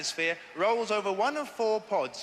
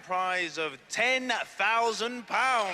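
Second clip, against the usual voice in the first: slow, drawn-out prize reveal; booming prize announcer; much slower than usual. Acted. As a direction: stretch out the big prize. Host names the big prize.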